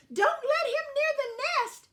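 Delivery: very high, loud screech. A narrator's reading. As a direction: screech a frantic warning. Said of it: "screeched Zinnia".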